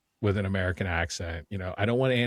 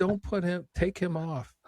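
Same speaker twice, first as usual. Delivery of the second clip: mock heckling; 9 semitones higher, slower than usual. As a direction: protest like a joking cinema heckler, pitched high. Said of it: "stand up and yell".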